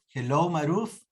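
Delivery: ordinary talk, nothing marked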